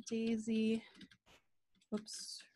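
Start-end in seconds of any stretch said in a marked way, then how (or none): 1.9-2.4 breathy whispered oops under the breath; a quick private whisper of a slip; whispered whoops. Real, not acted. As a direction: mutter it to yourself as you fix a typo. Typo while typing live demo.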